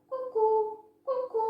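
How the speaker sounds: very high cuckoo calls. A narrator's reading. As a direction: sing it out like a cuckoo. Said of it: "Zakukała". (wysokie kukanie kukułki)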